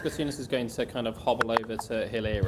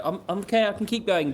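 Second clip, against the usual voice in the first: raised, louder, effortful protest; insisting he's okay; 9 semitones higher, 5 dB louder than usual. Acted. Lagging character assures the group he can continue, insistent. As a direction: push out a strained reassurance.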